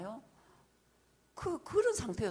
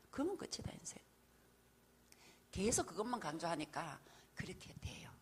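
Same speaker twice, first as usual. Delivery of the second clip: whisper; 3 semitones lower, 4 dB quieter than usual. Real, not acted. Soft aside during preaching.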